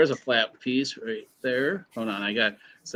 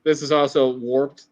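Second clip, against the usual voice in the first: ordinary talk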